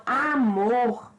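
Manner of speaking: slow "amor", second syllable MOR stronger; stress on MOR. Shown as a demonstration. Teacher repeating the example, "amor" stressed on MOR. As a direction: stretch "amor" and land firmly on mor. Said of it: "mor, que eu pronuncio com mais intensidade".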